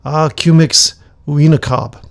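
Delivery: slow, loud call to the gods. Acted. Shaman calling loudly upon the water gods by name. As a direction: call the name out across the river. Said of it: "he called the name of the water gods".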